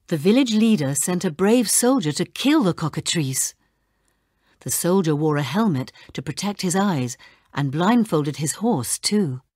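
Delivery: ordinary delivery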